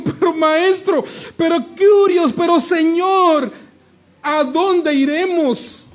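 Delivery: drawn-out, pleading cry to the master, rising to a shout; slow pleading disciples. Retold or imitated. Disciples led by Pedro pleading with their master, slow and imploring.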